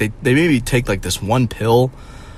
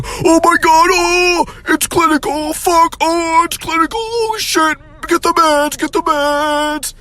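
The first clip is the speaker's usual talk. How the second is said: much higher, louder frantic doctor; hammed-up hysterical doctor; 3 dB louder, slower than usual. Boomer doctor diagnosing him, high and hysterical.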